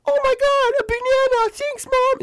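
put-on very high, gushing kid voice; excited little kid. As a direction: squeal it like a thrilled toddler. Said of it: "And he's like".

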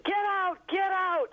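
high, loud imitated yell; mock screaming. Cab driver screaming at his passenger, a high, loud imitated yell. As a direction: throw her out in a retold screaming fit. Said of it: "started screaming".

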